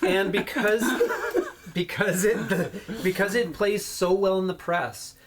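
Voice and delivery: words broken by laughter; laughing while talking. Real, not acted. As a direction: laugh through the explanation. Game master laughing mid-sentence.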